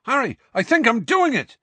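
high, loud thrilled cry; excited yell. A narrator's reading. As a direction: shout it out in triumph. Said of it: "yelled Seamus".

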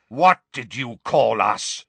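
slow, indignant challenge; mild outraged shout. A narrator's reading. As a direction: challenge the insult, drawing out each word.

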